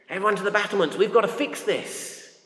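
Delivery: high-pitched imitation of alarmed townsfolk; rally everyone in mock urgency, without shouting; mock rallying call. Voicing Jerusalem's people rallying to defend.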